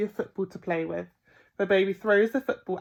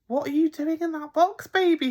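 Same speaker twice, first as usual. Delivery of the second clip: put-on higher, coaxing baby talk; 9 semitones higher, 4 dB louder than usual. A narrator's reading. Burglar asking the crying baby, coaxing. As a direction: coo it at the infant.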